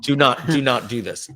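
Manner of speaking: mock plea, measured and deliberate; lightly dramatised begging, with weighty pauses; pleading with partners. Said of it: "I'll do my impression. I was like".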